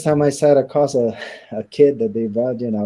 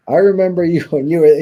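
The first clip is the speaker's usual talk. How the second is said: retold with a laugh, higher and louder; amused disbelief; 7 semitones higher, 8 dB louder than usual. Inmate reminding a changed old friend of his past, amused. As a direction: laugh through it as you recall him.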